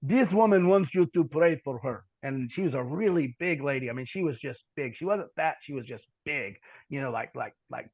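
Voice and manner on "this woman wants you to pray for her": put-on thick Ukrainian accent, slow and deliberate. Interpreter relaying a woman's prayer request, thick Ukrainian accent. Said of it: "he has a real thick accent".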